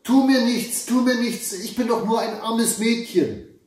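put-on high, pleading girl's wail; frightened cry. A narrator's reading. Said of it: "begann sofort zu schreien". (ängstliches, hohes Flehen)

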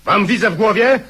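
high, loud accusing shout; a commander shouting down would-be rebels; furious shout. Acted. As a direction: hurl the accusation at them. Officer confronts rebels in quarrel.

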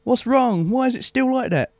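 high, whiny puzzled self-quote; confused. Retold or imitated. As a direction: pitch way up, baffled and fretting. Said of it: "I'm like".

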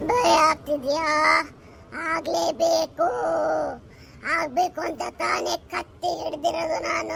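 deep, slow menacing spirit voice; low sinister ghost; taunting ghost. Acted. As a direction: gloat like a ghost holding a knife. Knife-holding ghost taunts them.

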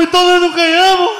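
high, loud stunned question; dismayed disbelief. Acted. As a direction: ask it high as the dream collapses. Ricardo crushed, asks if they lost.

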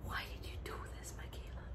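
barely audible, overwhelmed whisper; hushed, voiceless question. Real, not acted. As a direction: whisper it to the absent friend. Overwhelmed by friend's gift.

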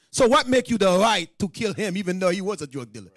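ordinary talk

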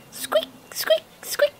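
high, soft squeaky mouse cries; tiny mouse squeaks. A narrator's reading.

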